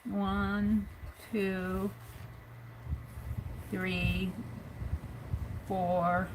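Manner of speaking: counting petals slowly, one per stroke; slow, spaced-out counting of "One, two, three, four, five, six". Shown as a demonstration. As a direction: count each petal as you draw it. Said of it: "we do a flower".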